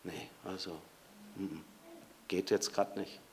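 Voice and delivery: very soft, low hemming refusal; hesitant brush-off. Acted. Preacher voicing a man fighting with his wife, hesitant mutter. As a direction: hum and haw it away quietly. Acting out the person in a quarrel.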